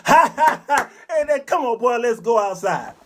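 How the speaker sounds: high laugh then cheery invite; friend laughing. Retold or imitated. Friend laughing with the mother, high cheery laugh. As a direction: laugh along, then call him out. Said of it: "her friend said".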